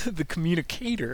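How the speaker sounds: mock the odd weight at the end of COMMUNICATOR; mimicking weird emphasis. Teasing co-host over the slip, mimicked weird emphasis on COMMUNICATOR. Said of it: "put really weird emphasis".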